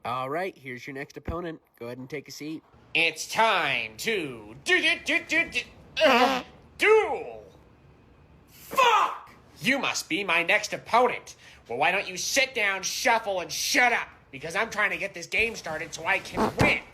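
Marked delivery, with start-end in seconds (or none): 2.9-7.4 slow build to a high, loud cry; dramatic duel cry. Acted. Dramatic announcement of a card duel. 8.5-9.3 very high, loud yelp; hammed-up shriek of a curse; acted outburst. 9.6-16.8 higher, loud, pushy duelist; bossy challenge. Acted. Duelist bossing the next opponent.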